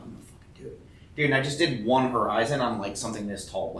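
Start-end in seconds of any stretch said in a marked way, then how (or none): 0.0-0.8 whispered to himself; whispered resolve. Retold or imitated. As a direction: hush it like a secret decision. Retold private resolve.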